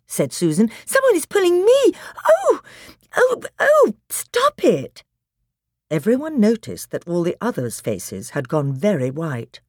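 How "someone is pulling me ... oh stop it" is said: very high, loud panicked protest; alarmed cries. Acted. Susan pulled by someone unseen, alarmed cries. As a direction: cry out as you're being pulled.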